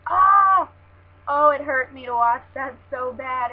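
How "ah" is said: sharp high yelp of pain; pained cry. Retold or imitated. Vlogger reliving the eye spray, loud pained yelp. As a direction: yelp as if the spray hit you. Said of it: "and I was like".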